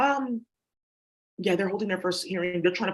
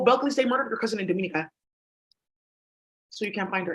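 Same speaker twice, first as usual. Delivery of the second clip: ordinary talk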